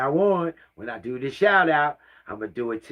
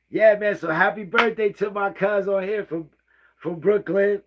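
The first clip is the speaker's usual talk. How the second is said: excited shout-out; 10 semitones higher, 6 dB louder than usual. Real, not acted. Birthday shout-out to cousin.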